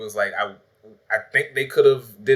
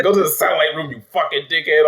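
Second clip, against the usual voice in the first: higher-pitched, half-laughing mock order; imitated snapping; 5 semitones higher, 3 dB louder, faster than usual. Friend re-quoting the lady who snapped, milder mock anger. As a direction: repeat the cussing-out with amused mock anger. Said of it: "snapped on that one dude".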